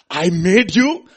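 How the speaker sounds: high, loud and emphatic cry; loud proclamation. Acted. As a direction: proclaim it as the maker. Boy speaks to his boat.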